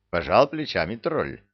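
ordinary delivery, nothing marked

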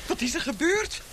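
high, alarmed calls for Mr Garnett; high anxious cries, slowing as she calls; alarmed calling out. Acted. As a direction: call out in rising panic after a crash. Landlady calls out after the crash.

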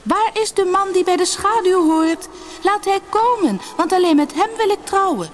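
very high, ringing summons; calling out. A narrator's reading. Sina summons the man she will marry, calling out. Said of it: "riep ze".

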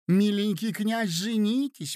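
high little squeak; squeaky plea. A narrator's reading. Third lady begs the prince to marry, squeaking. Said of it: "пропищала третья".

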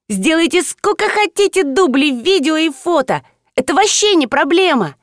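high, eager schoolgirl; школьница. A narrator's reading. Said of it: "выкрикнула школьница". (напористая школьница, высоким голосом)

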